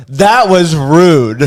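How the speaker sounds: loud, high, very slow rebuke; hammed-up wounded reproach; offended customer. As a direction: draw out each word in mock offence. Voicing the offended drunk customer.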